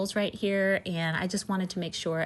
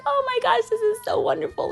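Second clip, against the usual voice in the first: very high, louder gushing squeal; retold delighted squeal; 5 dB louder than usual. Retelling her joy at the shop owner's reaction, high gushing squeal. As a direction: squeal it in thrilled disbelief.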